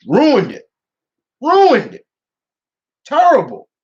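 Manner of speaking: high, loud real exasperation; fed-up wail, each verdict on its own; exasperated. Real, not acted. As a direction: condemn it in pained, high outbursts. Venting about a hated sequel.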